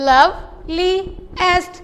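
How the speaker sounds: loveliest split into three slow syllables. Shown as a demonstration. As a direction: pull loveliest apart, syllable by syllable. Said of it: "इसको separate करें".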